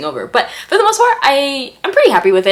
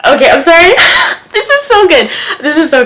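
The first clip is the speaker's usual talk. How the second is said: high, loud, squealing delight; giddy excitement; 10 semitones higher, 11 dB louder than usual. Real, not acted. Reacting to a romantic scene, high giddy squeal. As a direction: gush over the tension, giddy. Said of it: "I'm getting so giddy".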